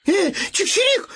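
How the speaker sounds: a high chirping shout; sparrow's cry. Acted. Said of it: "закричал воробей".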